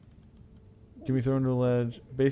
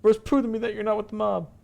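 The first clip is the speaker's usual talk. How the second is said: high, insistent character voice; high-pitched, wary challenge; suspicious man behind door; 12 semitones higher, 6 dB louder than usual. Acted. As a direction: demand proof in a high voice.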